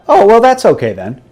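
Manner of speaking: high, dry mock approval; sarcastic fake relief. Sarcastic reply to "repentant.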